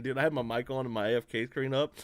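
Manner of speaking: usual speaking voice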